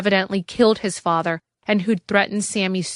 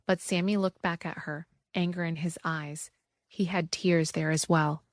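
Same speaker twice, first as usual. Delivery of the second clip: ordinary delivery, nothing marked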